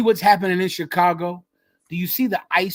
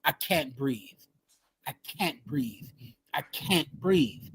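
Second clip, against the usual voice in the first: hushed, much softer retelling of a desperate plea; retold plea; 4 semitones lower, 7 dB quieter than usual. Host retelling a dying man's plea on video, hushed retold plea. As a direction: say it low and spent, pausing between repeats. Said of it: "as he screamed".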